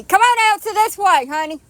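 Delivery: high, loud sweet call; calling a dog. Real, not acted. Owner calling her dog this way, high and loud. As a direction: sing it out to the dog.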